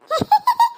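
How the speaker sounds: sudden high mocking giggle. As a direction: giggle at their trouble. Child laughs at others.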